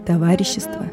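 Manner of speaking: usual speaking voice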